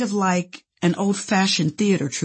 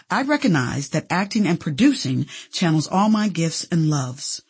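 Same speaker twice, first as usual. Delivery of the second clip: ordinary talk, nothing marked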